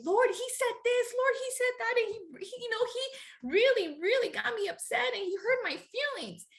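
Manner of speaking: high whiny complaint to the Lord; complaining to God. Retold or imitated. Praying in her closet, complaining about her husband in a high whine. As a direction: pitch it up and whine like a hurt child. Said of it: "pray in my closet and say".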